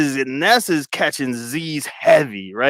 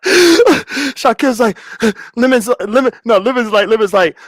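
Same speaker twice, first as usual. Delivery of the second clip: cracking up; 7 semitones higher, 3 dB louder than usual. Real, not acted. Restarting story through his laughter.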